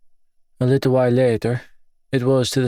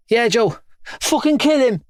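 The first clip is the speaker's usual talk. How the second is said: voiced young kid; 12 semitones higher than usual. Kid cheering on fight.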